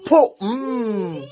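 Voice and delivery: explosive high "po", then a falling hum; imitated whip crack. Real, not acted. Healer whipping the spirit, imitated lash sound. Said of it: "ilalati ko ko".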